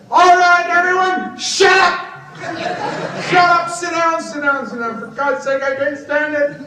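loud, high shout to silence the room; retold shout. On a TV panel, shouting down the other guests, furious. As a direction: yell at everyone to shut up and sit down. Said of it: "And I said".

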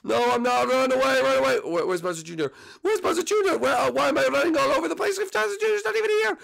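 high, frantic escape voice; high, urgent and panicking during the jailbreak; panicked prisoner. Acted. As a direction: scramble to get everyone out.

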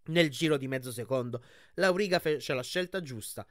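ordinary delivery

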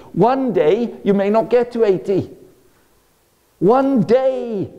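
ordinary talk

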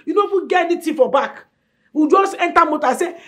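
ordinary delivery

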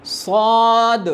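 صاد with heavy s, very long vowel; drawn-out madd. Shown as a demonstration. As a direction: stretch صاد slowly, full-mouthed. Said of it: "تھوڑا سا مد کے ساتھ پڑھتے ہیں". (لمبا، بھاری صاد)

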